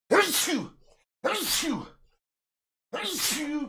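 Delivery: three loud sneezes in a row; real sneezes, one after another; high, sharp sneeze fit; sneezing. Real, not acted. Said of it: "thank you so much for the bless you".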